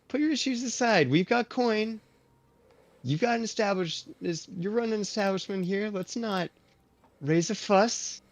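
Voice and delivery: high, loud, friendly calming-down call; loud genial Luther. Acted. Luther calms the barkeep, loud and genial. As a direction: talk over the barkeep cheerfully. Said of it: "talk even louder over the barkeep".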